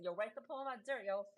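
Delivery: put-on playful New York accent, fast; tough-guy New York accent, quick. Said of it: "here's my New York accent".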